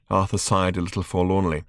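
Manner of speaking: plain reading voice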